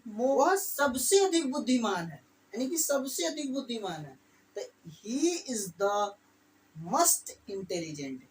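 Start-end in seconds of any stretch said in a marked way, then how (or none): 5.0-8.2 drawn-out, spaced model phrase; slow dictation. Shown as a demonstration. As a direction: dictate the phrase slowly for students. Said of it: "teacher dictating slowly".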